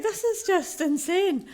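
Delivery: high, slow, emotional exclaim; overwhelmed. Retold or imitated. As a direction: gasp it out, beside yourself. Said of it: "I was like".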